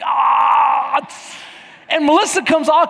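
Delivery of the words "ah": sharp pained yelp of a cramping muscle. Retold or imitated. Preacher imitating his cramping leg, pained yelp. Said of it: "this cheek was like".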